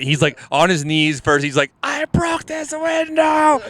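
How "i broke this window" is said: high, drawn-out mock wail; hammed-up freak-out, words stretched out; imitated tantrum. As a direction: wail it like a little baby throwing a fit. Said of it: "like throwing it like a little baby".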